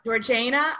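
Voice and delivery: Georgiana with JAY as the stressed syllable; alternative pronunciation. Shown as a demonstration. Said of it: "I've heard it pronounced".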